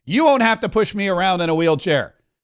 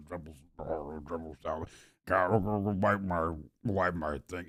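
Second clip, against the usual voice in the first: very low, soft garbled mumble; mock feeble, slobbering old-timer; drooling old person; 13 semitones lower, 14 dB quieter than usual. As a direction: mumble through a mouth full of drool. Mimics drooling old people.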